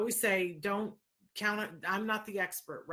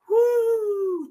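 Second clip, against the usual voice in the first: a big high joyful whoop, much louder; excited whoop; 15 dB louder than usual. Real, not acted. Host cheering a ten-year-old's wisdom, excited whoop. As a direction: cheer out loud in delight. Cheering her son's words.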